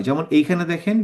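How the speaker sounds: ordinary talk, nothing marked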